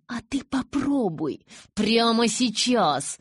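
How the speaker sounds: slow, high, insistent command; furious demand. A narrator's reading. Bella demands Jacob try right now, furious.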